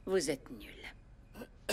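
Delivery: breathy scornful whisper. Acted. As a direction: hiss the verdict under breath. Mocking his attempt.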